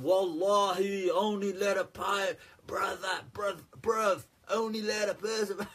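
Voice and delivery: a not-quite-full-on London accent imitation; London slang accent, imitating a preacher. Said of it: "the full-on London accent".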